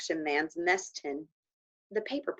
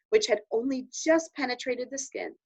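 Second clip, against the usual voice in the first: ordinary delivery, nothing marked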